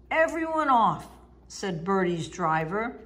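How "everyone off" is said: driver's raised call to passengers; order. Acted. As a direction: clear everyone off the bus.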